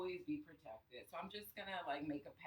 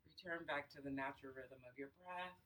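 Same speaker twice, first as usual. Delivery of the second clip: guided breathing; 5 dB quieter than usual. Acted. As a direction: count the breaths, unhurried and soothing. Leads a breathing exercise.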